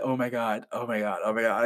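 hushed, low fangirl panic; soft, low stream of disbelief; mock freak-out. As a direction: repeat it low under your breath. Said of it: "just freaking out".

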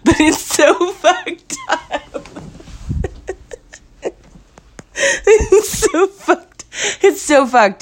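very high, stretched words squeaking through laughter; hysterical laughing. Real, not acted. Woman who knows she shouldn't laugh at her misfortune, hysterical laughing. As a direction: lose it completely, wheezing out each word slowly. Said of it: "I should not be laughing".

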